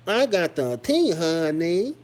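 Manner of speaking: a light sassy gossip voice, teasing; lightly voiced sassy gossip; mock sassy gossip. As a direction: mimic her sassy tea line, mocking. Mocking Tina's sassy boast.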